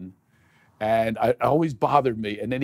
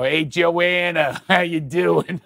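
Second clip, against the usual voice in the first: drawn-out, high Philly street greeting; Philly accent; 6 semitones higher, 4 dB louder, much slower than usual. Shown as a demonstration. Comedian showing the host her name in Philly, broad Philadelphia accent. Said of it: "in Philly, I go".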